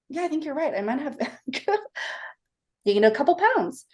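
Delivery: high, light laugh through the words. Retold or imitated. Daughter answering dad in the elevator, laughing it off. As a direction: shrug it off with a giggle.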